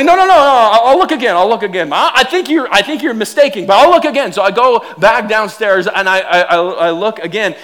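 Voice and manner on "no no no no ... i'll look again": hasty backpedal. Retold or imitated. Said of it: "So they".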